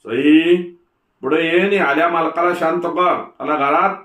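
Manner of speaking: sharp order. A narrator's reading. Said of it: "सासूनं दुसरा हुकूम सोडला".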